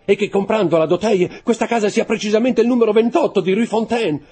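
high, loud, mounting protest; crying out. A narrator's reading. Steward protesting the choice of house, crying out. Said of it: "gridò l'intendente".

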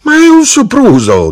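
very high, loud squeal; struggling protest. A narrator's reading. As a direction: cry out as you fight to get up. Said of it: "si dibatteva".

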